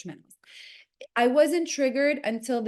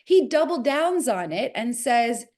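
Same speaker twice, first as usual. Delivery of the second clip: ordinary talk, nothing marked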